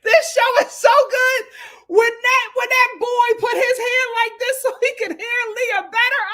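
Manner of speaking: high loud excited gushing; loud high fan excitement; thrilled shout. Real, not acted. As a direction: yell it out in delight. Thrilled by show moment.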